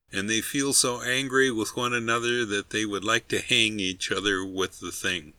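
plain reading voice

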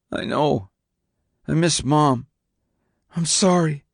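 high, small, sorrowful voice; tearful teenage son. A narrator's reading. Son admitting he misses Mom, sorry and tearful.

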